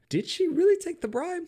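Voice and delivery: high, squeaky disbelief; mock shocked. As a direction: gasp it like a scandalized fan. Rhetorical question, put-on voice.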